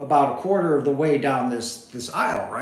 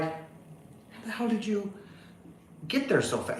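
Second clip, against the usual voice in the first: stunned question; 5 semitones higher, 11 dB quieter than usual. Retold or imitated. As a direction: breathe it out, thrown by the shock. Stunned by sudden stranger appearing.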